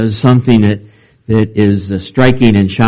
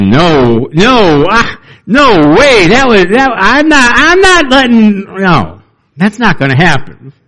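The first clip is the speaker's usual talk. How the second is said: much higher, hammed-up protest of a churchgoer; mock horrified refusal; 9 semitones higher than usual. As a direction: play a panicked parishioner backing away. Pastor voices a congregant refusing foot washing.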